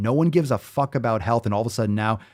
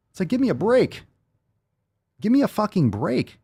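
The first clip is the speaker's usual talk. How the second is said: exasperated high cry; 9 semitones higher than usual. Real, not acted. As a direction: voice jumps up high in disbelief. Exasperated rant about covid fear.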